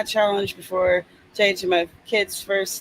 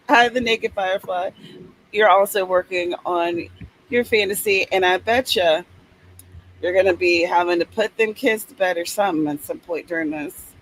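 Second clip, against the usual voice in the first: ordinary delivery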